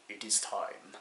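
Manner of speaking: low, very soft declaration; hushed mock-solemn. Driver steeling himself before speeding up, hushed and solemn. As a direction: drop it low and quiet, like a fateful moment. Quiet solemn self-announcement in story.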